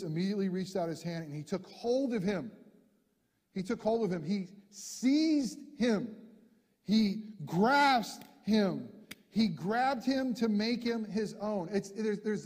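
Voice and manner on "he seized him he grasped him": seized and grasped drawn out heavily; slow, weighty stress. Shown as a demonstration. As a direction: lean hard into every verb, slowly. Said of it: "there's violence almost in this word".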